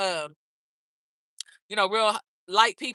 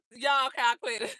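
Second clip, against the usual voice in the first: laughing and giving up; 6 dB quieter than usual. Real, not acted.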